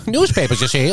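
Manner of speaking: hammed-up old film patter; put-on vintage screen voice; old-time movie talk. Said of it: "how they talked back then".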